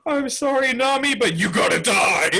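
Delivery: hammed-up regretful cry; mock apologetic wail. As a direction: start high and pleading, then drop. Acted apologetic wail of Zeus.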